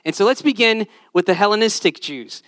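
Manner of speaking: ordinary delivery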